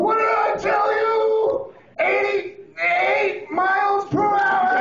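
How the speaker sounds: high, drawn-out frantic shout; Doc Brown. Acted. Comic quoting a movie scientist, manic shouting. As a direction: holler it at full pitch, stretching every word. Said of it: "Doc Brown will come on and I'm going".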